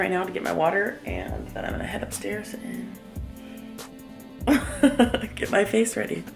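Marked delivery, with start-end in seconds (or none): none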